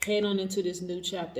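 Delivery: ordinary delivery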